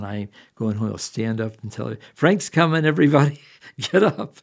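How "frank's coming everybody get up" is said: playful high, loud call; excited announcement. Pretend. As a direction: call out to rouse the whole crowd. Imagines announcing his arrival in heaven.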